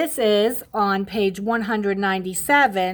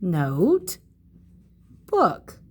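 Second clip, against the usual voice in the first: staccato; 4 semitones lower than usual. Shown as a demonstration. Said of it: "write both words you hear in the compound word".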